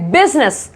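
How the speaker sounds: बिजनेस in two beats, biz-ness; short two-syllable बिजनेस; silent i. Shown as a demonstration. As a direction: say बिजनेस without the middle i. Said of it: "इसको बीजीनेस नहीं बोलते".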